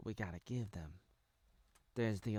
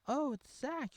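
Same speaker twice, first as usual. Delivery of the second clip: high, bright recognition; excited; slower than usual. Acted. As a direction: gush on seeing him. Excited recognition of former star.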